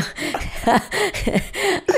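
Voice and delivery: a real laugh jumping way up; high laugh. Real, not acted. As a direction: burst into a high giggle. Laughs at own retold reaction.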